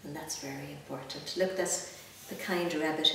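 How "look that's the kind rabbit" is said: plain reading voice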